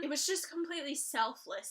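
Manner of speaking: ordinary delivery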